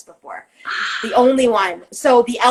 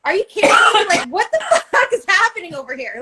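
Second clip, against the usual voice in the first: much higher, laughing through the words; laughing disbelief; 8 semitones higher than usual. Retold or imitated. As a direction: crack up in disbelief mid-sentence. Talking to herself, laughing.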